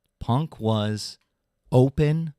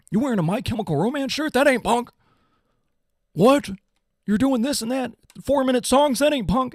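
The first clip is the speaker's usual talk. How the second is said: high whiny mock-punk sneer; high snotty impression of rule-bound punks; 10 semitones higher than usual. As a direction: lampoon the gatekeepers in a pitched-up voice.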